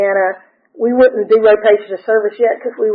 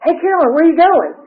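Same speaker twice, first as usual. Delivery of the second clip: boss calling out; 7 semitones higher than usual. Retold or imitated.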